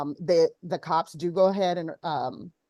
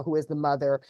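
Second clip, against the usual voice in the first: ordinary talk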